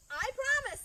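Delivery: high, loud squeaky promise; eager bunny promise. A narrator's reading. Bunny rabbit promises the scarecrow, high and eager. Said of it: "said the bunny rabbit".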